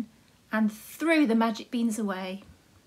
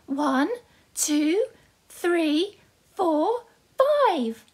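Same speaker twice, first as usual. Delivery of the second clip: rising, higher and higher excited count; Jack counting; 6 semitones higher, 4 dB louder, much slower than usual. Acted. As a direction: count proudly, climbing to the top. Jack counts coins to mum.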